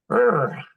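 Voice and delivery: short, loud "urgh"; sudden wordless grunt of effort; real groan. Real, not acted. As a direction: let out a brief, forceful groan. Wordless groan after emotional story.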